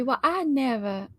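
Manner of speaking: a light, slow Southern belle drawl, airy and prim. Shown as a demonstration. Cohost shows the Southern belle accent, in a slow airy drawl. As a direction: tease as a demure Southern belle. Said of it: "it's like that airy".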